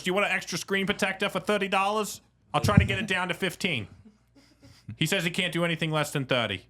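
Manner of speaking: high, pestering New Jersey woman impression; Northeastern accent. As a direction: nag George in a broad Jersey accent. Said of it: "That's not how she speaks".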